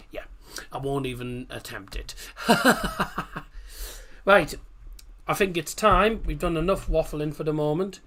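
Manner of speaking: usual speaking voice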